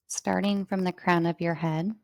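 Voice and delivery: ordinary talk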